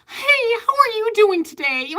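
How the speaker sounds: high, sugary, bright service voice; fake customer service voice. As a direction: put on a sweet fake-friendly greeting. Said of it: "they talk to a customer and like".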